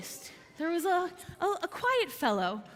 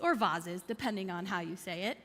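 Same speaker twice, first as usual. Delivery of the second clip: ordinary talk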